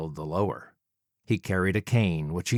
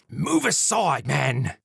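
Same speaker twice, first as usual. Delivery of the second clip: higher, haughty gentleman villain; arrogant Jack; 5 semitones higher, slower than usual. Acted. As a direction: brush him off like a dapper killer. Villain Jack voiced in story.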